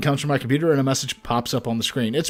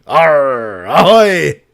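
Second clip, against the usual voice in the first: loud hammed-up pirate; 6 dB louder than usual. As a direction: bellow it like a pirate captain. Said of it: "talk like a pirate with me".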